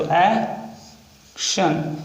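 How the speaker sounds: extremely slowed dictation; very slow stretched word. Shown as a demonstration. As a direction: pull the word out syllable by syllable. Repeats word while writing.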